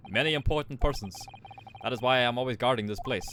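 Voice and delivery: put-on proud guard in a Russian accent. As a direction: boast about first class in a Russian accent.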